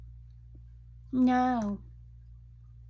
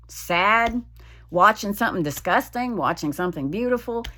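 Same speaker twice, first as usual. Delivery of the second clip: ordinary delivery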